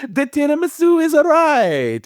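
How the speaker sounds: high, slow Italian flourish; mock Italian accent. Host echoes in character voice.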